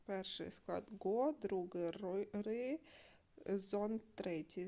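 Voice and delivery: горизонт split slowly as го, ри, зонт; syllable by syllable. Shown as a demonstration. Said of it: "наголос падає на третій склад". (по складах, повільно)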